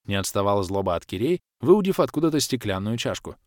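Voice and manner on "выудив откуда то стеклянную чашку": usual speaking voice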